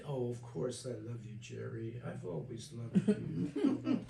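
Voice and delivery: slow, saccharine reassurance; fake sweetness. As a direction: drawl it out, too sweet. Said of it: "we practiced control and manipulation".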